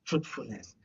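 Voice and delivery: ordinary delivery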